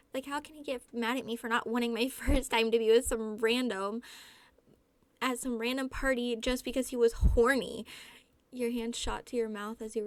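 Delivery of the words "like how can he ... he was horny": loud, worked-up complaint; indignant rant. A narrator's reading. Drunk guest complains to Kyle about Clyde, indignant. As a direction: protest loudly, stressing each grievance.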